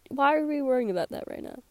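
ordinary talk

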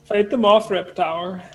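high, loud mock command; calling out. Adventurer sending a companion at the encounters, calling out. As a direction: shout orders to your companion in play. Player orders companion to fight.

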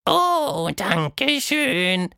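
high sing-song thanks; fluting. Acted. Monster thanking Tilda, high fluting voice. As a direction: flute your thanks proudly. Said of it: "flötete Quatschmonster".

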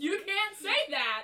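high, loud scandalised protest; outraged disbelief; shocked. Real, not acted. Scandalised reaction to joke.

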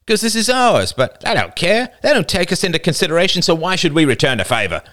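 high-pitched, heated argument; angry. A narrator's reading. Older boy arguing over his territory, angrily. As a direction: argue indignantly, pitched well up.

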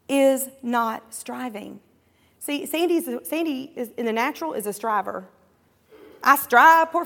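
normal talking voice

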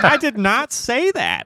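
high, drawn-out imitation of indignant denial; mock existential crisis. Podcaster teasing a friend over his crisis moment, mock-distressed denial. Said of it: "an existential crisis moment".

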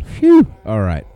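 mock relief from the linemen; relieved sigh. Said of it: "Their offensive line's going".

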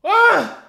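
piercing frustrated scream; high shrieking yell. Real, not acted. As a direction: let out a loud scream.

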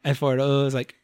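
usual speaking voice

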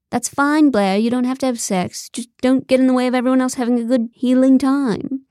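cartoonish British director; fake British accent. A narrator's reading. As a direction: answer in a phony British accent. George again in fake British accent.

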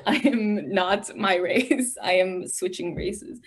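ordinary delivery

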